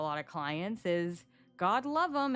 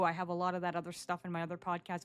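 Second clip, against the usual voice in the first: ordinary talk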